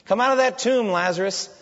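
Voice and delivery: loud, high call into the tomb; commanding call. Acted. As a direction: summon Lazarus out with force. Jesus calling Lazarus from tomb.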